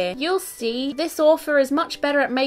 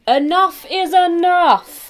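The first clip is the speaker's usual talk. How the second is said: raised, higher and louder, drawn out slowly; firm declaration; 6 semitones higher, 8 dB louder, slower than usual. Acted. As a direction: rectangle in the kids' book declaring war is over, loud and firm. Rectangle character's line in story.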